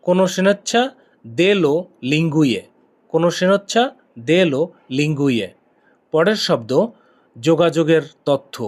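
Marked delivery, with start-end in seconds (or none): 0.0-2.6 conoscenza said clearly, then dello lingue drawn out very slowly; teacher spelling out "dello lingue" syllable by syllable; slow pronunciation demo. Shown as a demonstration. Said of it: "এর Italian হলো". 3.1-5.5 conoscenza said clearly, then dello lingue drawn out very slowly; teacher spelling out "dello lingue" syllable by syllable; slow pronunciation demo. Shown as a demonstration. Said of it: "এর Italian হলো".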